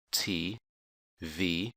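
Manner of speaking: usual speaking voice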